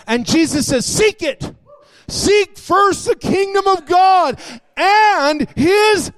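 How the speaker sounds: high, loud pulpit proclamation; full-force preaching. Acted. Preacher correcting the congregation on where the kingdom is, full-force preaching. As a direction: drive each phrase home, pitched high.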